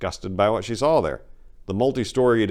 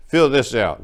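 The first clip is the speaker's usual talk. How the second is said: curt clerk; 5 semitones higher, 7 dB louder than usual. Acted. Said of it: "the clerk said".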